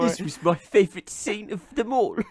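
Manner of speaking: lightly voiced pompous star; silly star voice. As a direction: boast about the film. Impersonating Depp in cinema.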